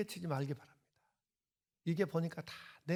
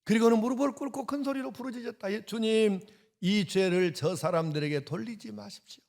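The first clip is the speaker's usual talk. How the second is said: louder, higher, proclaiming the prayer; loud fervent reading; 7 semitones higher, 6 dB louder than usual. Shown as a demonstration. Pastor rereading Stephen's prayer to the church, fervent. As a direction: cry it out as Stephen did. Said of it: "이 기가 막힌 평안한 이야기".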